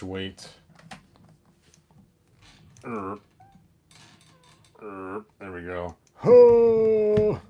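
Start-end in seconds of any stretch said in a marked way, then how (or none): 6.1-7.4 sudden loud high "ho" of effort; a loud yelp while catching a falling toy; effortful grunt. Real, not acted. Collector balancing a heavy figure, loud startled grunt.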